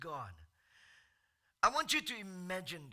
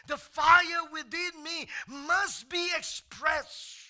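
loud, higher preaching at full force; preacher firing up the congregation; fiery preaching; 6 semitones higher, 9 dB louder than usual. Acted. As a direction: stir the church up.

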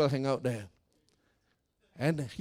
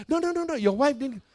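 hasty denial; 9 semitones higher, 4 dB louder than usual. Retold or imitated. Acts pastor hastily denying it.

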